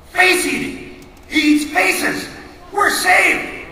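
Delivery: high, loud, excited cry; relieved kid. Acted. Kid realising the duck will eat the face, high and excited. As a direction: burst out with sudden hope.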